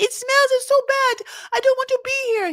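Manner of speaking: complaining wife mocked in a French accent. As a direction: imitate Manon whining in a French accent. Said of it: "My French accent is".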